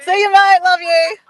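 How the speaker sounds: high, loud farewell; waving off a friend with a high loud call; calling goodbye. Real, not acted. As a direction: shout a fond goodbye.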